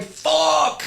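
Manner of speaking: high, softened cry of dismay; mock-anguished swear, held back; retold frustrated curse. Reacting as the door opens mid-act, frustrated curse.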